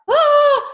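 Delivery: high, loud "ah" to hold back tears; sudden high sigh, fighting emotion; emotional exhale. Real, not acted. Woman overcome remembering her mom, emotional high "ah". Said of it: "I can get emotional".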